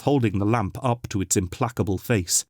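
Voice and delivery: ordinary delivery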